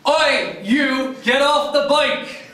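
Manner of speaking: high, loud "Oi!" yelled at an offender; hammed-up telling-off shouted across the street; mock angry shout. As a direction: holler it sharply, far above normal pitch. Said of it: "as in".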